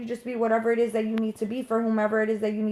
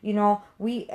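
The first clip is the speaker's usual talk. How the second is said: ordinary delivery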